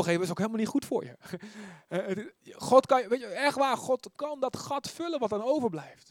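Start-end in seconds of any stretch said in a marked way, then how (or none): none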